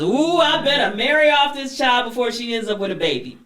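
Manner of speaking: a bossy grandmother deciding fast. Retold or imitated. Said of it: "Her grandmother catches her and says".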